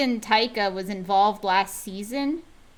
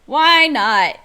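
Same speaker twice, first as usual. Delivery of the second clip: louder, punchier second "Why not?"; emphatic repeat; 4 semitones higher, 8 dB louder than usual. Real, not acted. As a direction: hit "Why not?" hard like a rallying cry. Repeats the rhetorical question louder.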